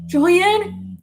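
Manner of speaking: high, loud call to a brother; imitated yell. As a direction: call out as if searching for someone far off. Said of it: "you hear him yell to my brother".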